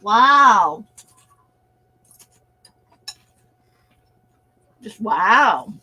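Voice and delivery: talking while crunching; words round a mouthful of chips. Real, not acted. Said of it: "I'm sorry if I'm crunching".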